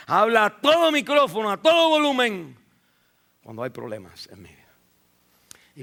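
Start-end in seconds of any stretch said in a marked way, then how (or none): 0.1-2.6 louder and higher on "todo"; preaching at full volume. Acted. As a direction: let it ring out. (predicando a todo volumen)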